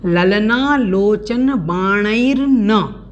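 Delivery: "ललना लोचन बाणैर्न" said very slowly, each syllable clear; slow, deliberate "बाणैर्न" with r and n joined; careful pronunciation. Shown as a demonstration. Teacher repeats the phrase slowly for students to copy. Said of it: "इस प्रकार से इसको बोलना है".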